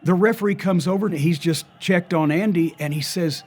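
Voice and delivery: ordinary talk, nothing marked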